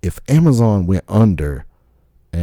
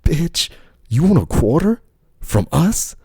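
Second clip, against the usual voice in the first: high mocking disbelief; mock incredulous; 10 semitones higher than usual. McDonald's mocking the old lady, high scornful voice. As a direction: sneer it like a scornful company rep. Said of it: "They're like".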